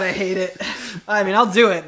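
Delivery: ordinary talk, nothing marked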